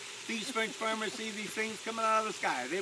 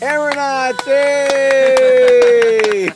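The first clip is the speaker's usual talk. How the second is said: loud, high, drawn-out cheer; celebratory cheer; 6 semitones higher, 22 dB louder, much slower than usual. Real, not acted. Pilot declares the two passengers aeronauts, loud cheering. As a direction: shout it out in triumph, stretching the yay.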